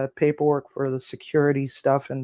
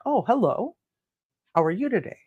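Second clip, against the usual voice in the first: confused; 6 semitones higher than usual. Retold or imitated. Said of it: "Kind of confused".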